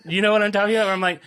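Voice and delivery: ordinary talk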